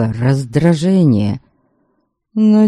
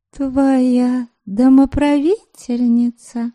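simpering mistress; 7 semitones higher, slower than usual. Acted. Same coy mistress speaks.

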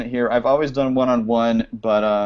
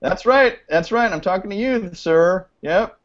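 high, teasing call-out; single out the culprit playfully; pitched-up mock accusation; mock scolding; 8 semitones higher than usual. Mock scolding, pointing at guest.

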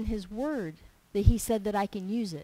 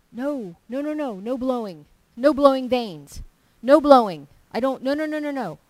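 loud, emphatic backpedalling; acted alarm, hammering each refusal; mock panicked protest; 3 semitones higher, 10 dB louder than usual. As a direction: hurry to take it back, loudly. Acted panic after blurting wrong words.

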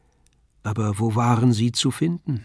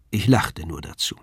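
ordinary delivery

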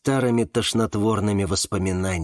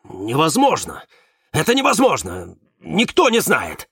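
higher-pitched outburst of disbelief; blurted out; 5 semitones higher, 3 dB louder than usual. A narrator's reading. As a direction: blurt it out in alarm, voice raised. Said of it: "и выпалил". (выпалить на повышенных тонах)

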